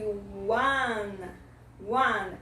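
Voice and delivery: ONE drawn out as 'wuhn'; teacher's model of ONE with a clear W; W sound. Shown as a demonstration. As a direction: exaggerate the W glide opening ONE. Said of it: "É o som da letra W".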